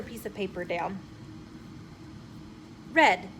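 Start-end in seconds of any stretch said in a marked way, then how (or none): 2.9-3.3 loud, quick call for a crayon. A narrator's reading. Girl calls to her helper for a red crayon, loud call. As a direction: snap the colour out to your helper. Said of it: "called B B".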